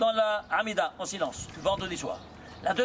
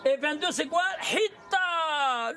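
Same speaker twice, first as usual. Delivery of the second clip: raised, high-pitched question and drawn-out answer; excited reveal; 5 semitones higher, slower than usual. Real, not acted. Teacher asks what twenty-two is and answers, excited. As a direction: ask it eagerly, then stretch the answer out high.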